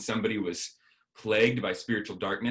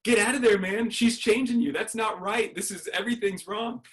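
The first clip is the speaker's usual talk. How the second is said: high, frantic outburst that fades as it goes; freaking out; 12 semitones higher than usual. Retold or imitated. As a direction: panic at your friend to get out. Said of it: "I kind of freaked out".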